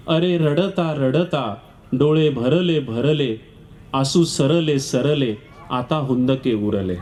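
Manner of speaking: slow, low recited verse; poem recitation. Acted. Reciting the widow's poem to the audience, slow and low. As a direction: recite the mourning verse slowly.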